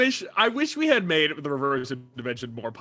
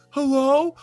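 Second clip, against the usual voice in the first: higher pitched trapped character calling out; trapped voice bit; 10 semitones higher, slower than usual. Retold or imitated. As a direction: play someone stuck in another world. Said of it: "little improv bit".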